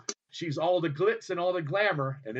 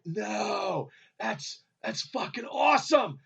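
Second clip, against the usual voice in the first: excited; 4 semitones higher, slower than usual. Retold or imitated. Excited reply to wife about photo.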